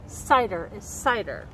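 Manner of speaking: slow, lilting picture-book read with long pauses before each ending; reading to children. A narrator's reading. Nature educator reading a water cycle book to kids, in a slow storytelling voice. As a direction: savour each rhyme like a storyteller at circle time.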